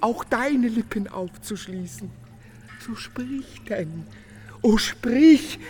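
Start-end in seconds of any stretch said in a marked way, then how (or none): 2.8-4.0 hushed and slow, almost breathless; soft plea. Acted. Nolten coaxing Konstanze to speak, hushed and slow. 4.6-5.6 loud, pleading burst; urgent cry. Acted. Nolten urging Konstanze loudly to answer him.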